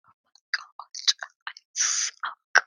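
breathy unvoiced groan of defeat; whispered despair. Real, not acted. Podcaster whispering at herself after losing her thread. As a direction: whisper it, fed up with yourself.

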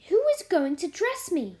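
high, loud imperious demand. A narrator's reading. Mary asking the maid who will dress her, demanding. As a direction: demand it like a little mistress. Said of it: "demanded Mary".

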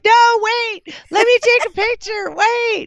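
very high-pitched, frantic pretend plea; hammed-up high cry to wait; mock pleading call. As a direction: squeal after someone to stop, playfully. Acts out calling after a flasher.

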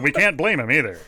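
ordinary delivery

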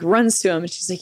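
usual speaking voice

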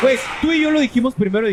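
indignant protest. Real, not acted. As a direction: shout the objection. Protesting co-host's contradiction.

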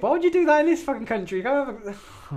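high, indignant rant; outraged disbelief. Real, not acted. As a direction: squeal it in disbelief. Outraged rhetorical question.

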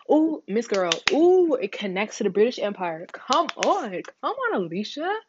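high-pitched mock cheering; playful self-hype. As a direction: hype yourself up, pitch leaping on "come on". Playfully hypes herself up for her answer.

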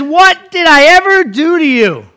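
high, loud, slow mock yell; indignant yell. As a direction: yell it at the donkey, stretched out. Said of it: "He yells at his donkey".

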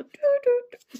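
hoch und laut anklagend; anklagendes Du. Real, not acted. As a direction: zeig laut auf sie. Anklagend gerufen. (hohes, lautes Du du du)

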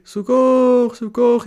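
high loud desperate calls; imitated distress calls, high and loud; cry for help. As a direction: call out in real distress. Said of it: "começou a ouvir".

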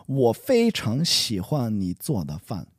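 做的饭 said without 早, reading from the typo; misread line. Shown as a demonstration. Student reading the typo version, 做的饭 without 早. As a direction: read it as 我非常喜欢你做的饭, leaving out 早 before 饭. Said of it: "I've had a typo here".

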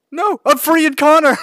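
high, loud objection; loud protest. Real, not acted. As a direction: shout it over the others. Protesting in-game action.

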